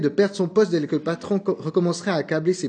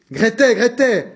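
louder, higher call to the next room; 6 semitones higher, 6 dB louder than usual. A narrator's reading. Mother calling her daughter through the wall, loud. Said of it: "appela-t-elle".

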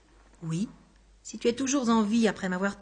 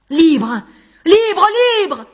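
loud cries, higher each time; shouting; 7 semitones higher, 11 dB louder, much slower than usual. A narrator's reading. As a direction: yell it with each blow. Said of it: "il criait". (Anguste crie à chaque coup, de plus en plus fort)

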